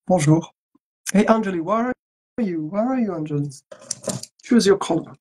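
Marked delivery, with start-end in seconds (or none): none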